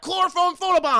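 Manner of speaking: high, loud, hammed-up battle cry; mock attack cry. Narrator announcing Spellbinder's surprise attack, high mock yell. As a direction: yell it like naming a special attack.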